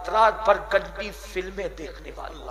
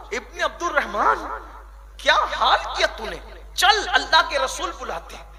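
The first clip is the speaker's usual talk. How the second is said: calling out; 7 semitones higher, 5 dB louder than usual. Acted. Umar calls the weeping youth.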